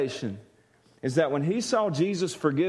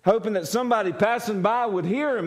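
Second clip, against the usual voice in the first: usual speaking voice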